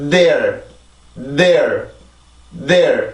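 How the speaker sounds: "there" as one syllable with a long voiced TH; English TH onset. Shown as a demonstration. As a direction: build sound in the TH, stress the vowel, relax into R. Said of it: "이렇게 해서 발음이 돼야 돼요". ("there"의 앞소리를 충분히 낸 일음절 발음)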